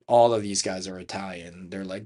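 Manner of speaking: ordinary talk, nothing marked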